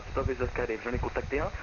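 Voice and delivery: usual speaking voice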